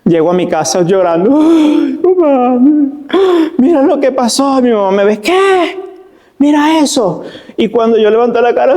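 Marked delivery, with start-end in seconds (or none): 1.4-4.6 mock crying child. Crying boy to mother. 5.2-7.2 mock shocked mother. Shocked mother seeing wound.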